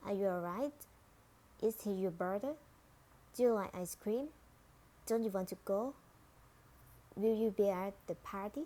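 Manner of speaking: "right", "brother", "cream", "go", "party" lifted into questions; rising intonation. Shown as a demonstration. Teacher models yes-no questions, rising at "right" to "party". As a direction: end each question on a rise, through to "party". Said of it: "rising intonation".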